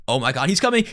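ordinary talk

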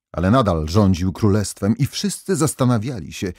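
plain reading voice

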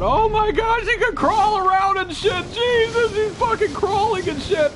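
high, loud panicked cries; freaked-out yelling. Real, not acted. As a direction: yell as it crawls at you. Monster crawling toward him.

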